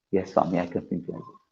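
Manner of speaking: a humble devotee's soft assent; meek reply. Retold or imitated. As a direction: answer meekly, almost under the breath. Devotee meekly agrees.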